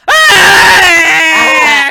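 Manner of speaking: high, loud shrieking cry; monster shriek. Acted. GM voicing the sickly woman spotting Tico, shriek. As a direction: let out a sudden screeching attack cry. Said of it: "turns and looks at you and goes".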